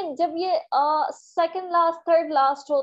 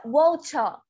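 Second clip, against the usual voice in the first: British "वाचा", ch-like T and no final R; water as British "wau-cha"; British English; slower than usual. Shown as a demonstration. Teacher shows British sound, ch-like T in "वाचा". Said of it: "British English बोल रही हूँ".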